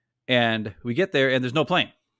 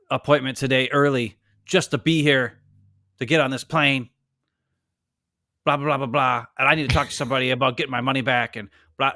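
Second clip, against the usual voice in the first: pissy complaint. Retold or imitated. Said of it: "getting all pissy".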